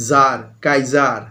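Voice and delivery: slow, careful foreign "জার, কাইজার"; foreign pronunciation. Shown as a demonstration. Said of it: "যেমন জার". (বিদেশি ঢঙে ধীরে "জার, কাইজার")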